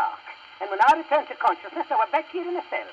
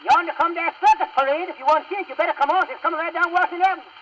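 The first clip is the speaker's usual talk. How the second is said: higher-pitched, eager call to hurry; excited urging; 5 semitones higher, faster than usual. Acted. Henry calls the judge out to the circus parade, excited urging. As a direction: rush them along with rising excitement.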